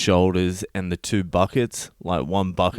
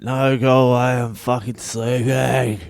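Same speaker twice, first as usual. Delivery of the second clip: irritated yell; 4 semitones higher than usual. Retold or imitated. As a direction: re-enact yelling at someone through the door. Yelling at knocker through door.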